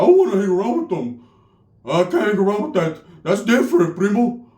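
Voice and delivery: imitating a rattled cousin; freaked-out primo. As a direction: mildly panicky put-on primo voice. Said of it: "he was really, really, truly freaked out".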